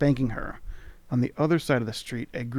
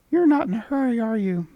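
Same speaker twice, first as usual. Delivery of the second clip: much higher, squeaky sprite voice; charming tiny creature; 10 semitones higher, 5 dB louder than usual. Acted. Tiny creature charmingly greeting the traveller. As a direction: chirp it like a tiny fairy. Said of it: "it observes".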